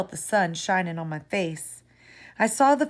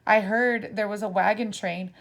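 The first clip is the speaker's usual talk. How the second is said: ordinary delivery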